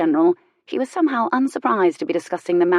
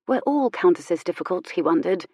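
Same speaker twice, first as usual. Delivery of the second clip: plain reading voice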